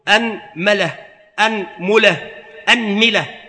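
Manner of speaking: أنملة with fatha on the hamza, mim read a, u, i; teacher drilling أنملة vowel by vowel; vowel variants. Shown as a demonstration. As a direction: say أنملة three ways, anmala, anmula, anmila. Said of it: "وفي الميم ثلاثة أوجه".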